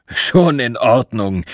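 slow, amused bear voice, a hint of a chuckle; slow old bear, lightly chuckling. A narrator's reading. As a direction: let it roll out slowly, kindly amused. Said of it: "kicherte der Bär".

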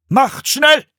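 loud, high shouted order; shouted command in alarm; urgent call. A narrator's reading. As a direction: hurry the other monks. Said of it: "rief er den anderen Mönchen zu".

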